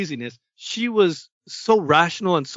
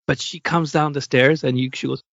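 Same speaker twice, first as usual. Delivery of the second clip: ordinary delivery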